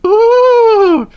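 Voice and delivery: very high, loud shrieking ooh; a frightened coach squealing on the curves; piercing high scream; coach's scream. A narrator's reading. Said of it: "screamed the coach".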